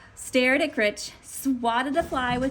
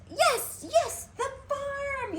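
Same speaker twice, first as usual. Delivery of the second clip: excited discovery; slower than usual. A narrator's reading. Grinch hits on the idea.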